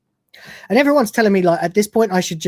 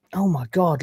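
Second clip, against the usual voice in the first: normal talking voice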